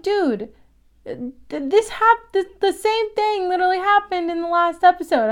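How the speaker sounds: 화면 속 인물들에게 어이없어 노래하듯 높은 목소리로; exasperated, singsong. Retold or imitated. Speaker exasperated at characters.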